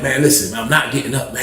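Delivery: low, subdued grumble; reluctant refusal. Retold or imitated. Sleepy man turning down his excited cellmate, low reluctant protest. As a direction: refuse from bed, low and soft.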